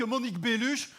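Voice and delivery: unglamorous Belgian French accent; deflating Belgian-accented version of the name. Shown as a demonstration. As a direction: drop BELLUCHE in a plain Belgian accent. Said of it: "notre accent".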